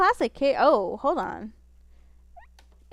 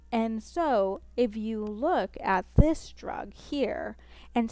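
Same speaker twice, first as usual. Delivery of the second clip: very slow, dragged-out lecturing; slow professor voice. Shown as a demonstration. Showing the chat how slowly her professors talk. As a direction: drag every word like a dull lecturer. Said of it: "I will show you guys how slow most of my professors talk".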